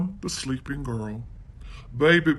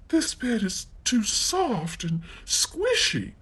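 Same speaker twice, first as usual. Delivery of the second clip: mumbling; 7 semitones higher than usual. Acted. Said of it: "she mumbled".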